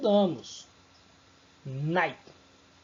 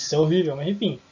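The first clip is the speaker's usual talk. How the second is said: ordinary delivery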